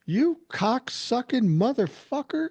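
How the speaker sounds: high, slow, furious cursing at a driver; angry swearing. Retold or imitated. Wife cursing at a driver who cut her off, slow furious swearing. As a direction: let the curse rip out slow and high. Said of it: "and she's angry".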